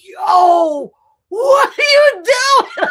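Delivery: reacting to Candace's bed remark with a stunned shout; incredulous shout. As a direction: shout it in disbelief at a friend. Retold outburst at Candace.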